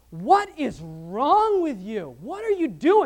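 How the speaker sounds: a loud, slow, high What is wrong with you; incredulous friends. Retold or imitated. Friends watching him sell everything, loud slow disbelief. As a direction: call out in disbelief at a friend gone mad. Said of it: "his friends are like".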